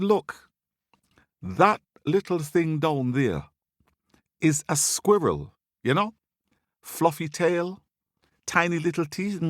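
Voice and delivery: high, pointed explaining in short pieces; condescending high monkey voice, phrase by phrase; exasperated monkey. Acted. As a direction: spell it out high, as to a fool. Monkey explains squirrel to Lion pointedly.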